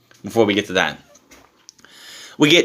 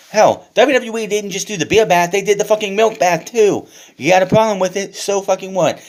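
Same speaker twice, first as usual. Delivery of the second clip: higher, louder ranting; heated rant; 6 semitones higher, 3 dB louder than usual. Real, not acted. Vlogger ranting at complaining fans, higher and louder. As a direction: rant it, daring anyone to object.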